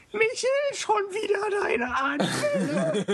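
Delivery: high laughing mock scold; laughing shout. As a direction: shriek it through laughter. Mocking a fit, laughing.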